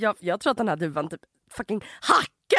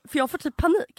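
ordinary talk, nothing marked

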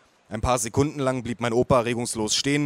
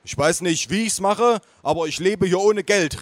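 calling out; 6 semitones higher than usual. A narrator's reading. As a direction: announce it proudly to the room. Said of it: "rief er".

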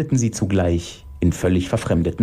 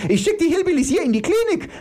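very high, rapid screech; shrieking; faster than usual. Acted. Iris threatening the others, shrieking. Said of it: "gilft Iris".

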